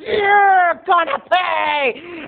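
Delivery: high, drawn-out squabbling screech; hammed-up sisters' fight, screamed slowly; screaming aunt. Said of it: "as Aunt Lauren was screaming".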